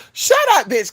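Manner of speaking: sudden very high outburst; involuntary blurt. Acted. Rude thought slipping out, Tourette's-like blurt. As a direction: blurt it as if it escaped. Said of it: "kinda like Tourette's".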